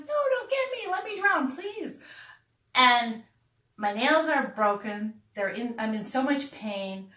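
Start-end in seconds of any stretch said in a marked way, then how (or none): 0.1-2.0 high plea fading to nothing; mock mortified plea. [name] begging peers not to come, high fading plea. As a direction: beg them away, voice dying out. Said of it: "And I'm like".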